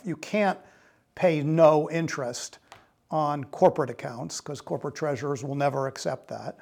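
normal talking voice

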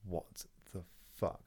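a slow, quiet, stunned what the fuck; dazed murmur. Retold or imitated. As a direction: mutter it half-awake, not believing it. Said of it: "I was like".

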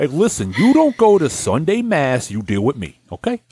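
put-on North Jersey accent. Joins North Jersey bit.

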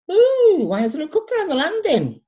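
put-on high, bright child's voice asking innocently; wide-eyed little girl. Teller as a little girl, asking her friend, high and innocent. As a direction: play her younger self puzzled by the cooker.

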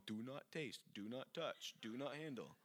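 soft, sneering sarcastic mockery; mock the rules sarcastically. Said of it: "this is very sarcastic".

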